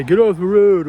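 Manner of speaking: loud, hostile "get off the road"; angry driver yell. Retold or imitated. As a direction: bark it at a cyclist from a passing car. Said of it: "someone to yell at me".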